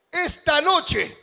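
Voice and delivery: slow dramatic build. Acted. Preacher building wedding night drama.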